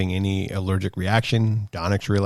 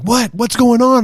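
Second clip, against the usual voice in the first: high, louder startled questions; retold sudden alarm; mock-startled shout; startled friends; 7 dB louder than usual. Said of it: "they're like".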